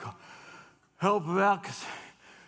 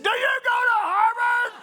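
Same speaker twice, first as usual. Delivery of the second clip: shouted question; 6 dB louder than usual. Retold or imitated. Bob yelling the question back at the Boston waitress, full shout. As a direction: yell it, much higher and louder.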